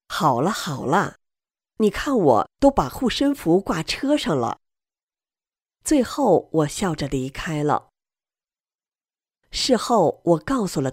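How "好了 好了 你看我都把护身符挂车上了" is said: ordinary delivery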